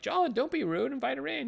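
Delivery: high-pitched imitation of a chiding parent. Parents telling their son to be polite to a visitor, chiding. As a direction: scold lightly in a high put-on voice. Parents scold boy, acted in retelling.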